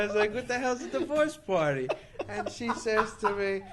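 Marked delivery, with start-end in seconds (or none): 0.3-1.9 loud, high-pitched incredulous question; baffled disbelief. Retold or imitated. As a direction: replay your bewilderment, pitched up and louder. Retold baffled question to the woman.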